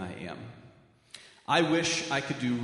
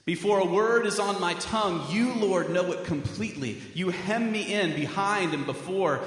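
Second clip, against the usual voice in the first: rising, fervent psalm reading from the pulpit; scripture reading; 3 semitones higher than usual. Acted. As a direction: read the psalm like a prayer building in force. Reads a psalm aloud.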